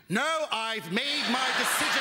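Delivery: slow refusal while falling; mock stubborn skydiver. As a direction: refuse help, slow and firm. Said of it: "pull the string".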